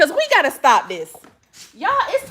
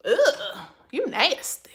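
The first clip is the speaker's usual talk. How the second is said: disgusted whisper; 3 semitones lower, 6 dB quieter, slower than usual. Real, not acted. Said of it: "disgusted at a comment".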